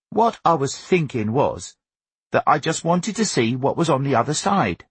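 put-on high-pitched, earnest young son; explaining boy. A narrator's reading. Augustus explaining his motive to father, earnest. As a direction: plead your reasoning in a boy's voice.

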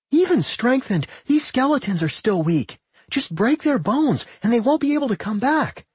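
high-pitched combat callout; rally allies with urgent advice; battle call. A narrator's reading. Nat telling comrades how to beat skeletons, calling out.

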